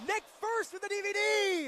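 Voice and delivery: high, loud move call; excited shout. Real, not acted. Commentator calling a neck-first slam, excited shout. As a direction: belt it out at the impact.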